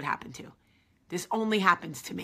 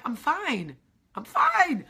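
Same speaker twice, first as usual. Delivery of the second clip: high, louder insistence that all is fine; forced fine; 6 semitones higher, 5 dB louder, slower than usual. Retold or imitated. To the executives, forced high insistence. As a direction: brush it off, too loud to be true. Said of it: "I'm like".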